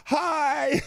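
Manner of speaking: high, loud, drawn-out greeting; mock-friendly hi. Laughing man greets the staring group, mock-friendly hi. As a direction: sing out a teasing hello.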